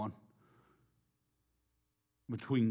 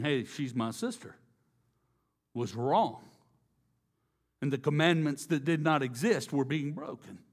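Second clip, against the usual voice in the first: loud, higher, ringing sermon voice; preaching at full force; 4 semitones higher, 5 dB louder than usual. Acted. Preacher convicting the congregation of sin, loud and high. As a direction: press the congregation hard.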